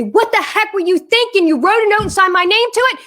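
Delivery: high, loud exasperated scolding; angry outburst. Shown as a demonstration. Mom acts what she held back from daughter, angrily. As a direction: let the mock fury rip. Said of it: "I didn't say".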